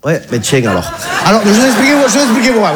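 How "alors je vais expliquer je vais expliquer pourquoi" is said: laughing. Real, not acted. Laughing as he starts.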